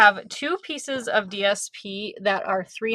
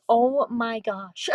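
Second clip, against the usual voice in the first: gushing; 2 semitones higher, much slower than usual. Real, not acted. Gushing over a cute gift.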